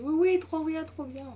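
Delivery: high, eager imitation of a friend saying yes; high-pitched mock enthusiasm; excited quote. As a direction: act out her delighted agreement, pitch leaping up. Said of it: "Elle m'a dit".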